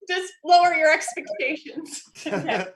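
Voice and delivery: ordinary delivery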